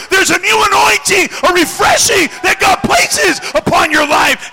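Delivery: swelling to a high, loud shout; full-force preaching. Real, not acted. Preacher promises new anointing to the crushed, shouted preaching. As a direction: build relentlessly to a climax.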